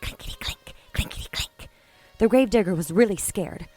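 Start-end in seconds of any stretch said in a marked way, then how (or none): 0.0-1.7 whispered rattle of coins; eerie whisper. A narrator's reading. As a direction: hush it down to a whisper.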